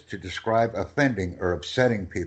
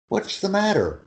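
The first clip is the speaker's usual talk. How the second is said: concerned high; 10 semitones higher than usual. Shown as a demonstration. Tutor acting concerned phrase.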